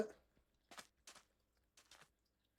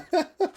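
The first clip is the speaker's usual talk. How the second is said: burst of laughter; 5 dB louder than usual. Real, not acted. Laughs at chat message.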